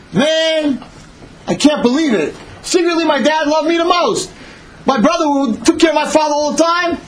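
high-pitched gloating amazement; gleeful disbelief. Acted. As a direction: crow in a high, excited voice. Favored son gloats in disbelief.

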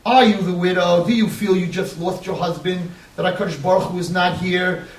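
normal talking voice

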